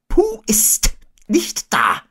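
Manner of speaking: high, slow owl voice; Eule. A narrator's reading. Owl reporting back to the boy, high and slow. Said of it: "sagte sie".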